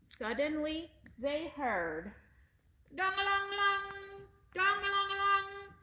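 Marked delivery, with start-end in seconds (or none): 2.9-5.7 high sing-song bell ringing in words. Acted. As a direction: chime it out like a ship's bell. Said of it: "ringing his bell".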